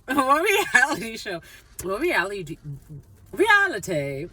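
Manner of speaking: high, hammed-up British accent. Shown as a demonstration. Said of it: "here we go. The British accent".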